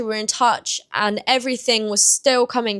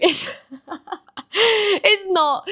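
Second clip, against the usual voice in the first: real laugh breaking through the words; giggling denial; 9 semitones higher, much slower than usual. Real, not acted. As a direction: laugh it out, high-pitched. Laughs after saying it sounded like a joke.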